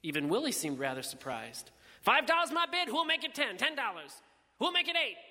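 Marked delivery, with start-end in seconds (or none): 2.0-5.2 high, ringing auctioneer's chant. A narrator's reading. Willie driving up the bidding, high auctioneer's call. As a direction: work the bidders for a higher price.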